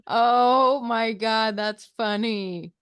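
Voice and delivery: normal talking voice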